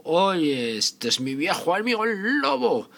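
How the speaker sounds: loud, high surprised hunter; loud exclamation. Acted. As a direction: shout out in surprise. Hunter finds the wolf.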